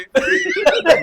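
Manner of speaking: a high loud laugh; amused laugh. Real, not acted. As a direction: burst out laughing. Laughing at passenger's reply.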